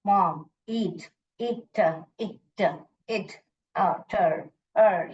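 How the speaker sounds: "मॉम, ईट, इट" sound by sound, slowly with gaps. Shown as a demonstration. Teacher breaking a word apart for young readers, sounded out. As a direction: break "ईट" and "थर्ड" into separate, slow sounds. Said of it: "फिर बाद में उसको जोड़ के पढ़ो".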